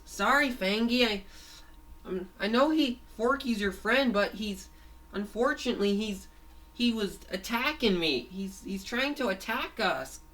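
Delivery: high-pitched cartoon character explaining himself; earnest excuse. Fango explains to Fangy why he fought Forky, earnest excuse. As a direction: plead your case, pitched well up.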